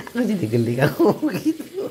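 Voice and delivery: amused laughing line. Real, not acted. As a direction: say it while cracking up. Husband laughs at wife.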